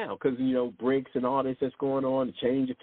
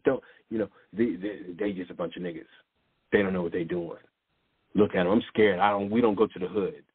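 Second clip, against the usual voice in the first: lightly voiced scoffing onlooker; mocking quote; 6 semitones lower, faster than usual. Mocking what others say.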